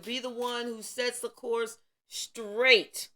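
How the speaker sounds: rising preacher's fervour; preaching. Acted. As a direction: preach it like a pulpit call. Preaching to viewers, building up.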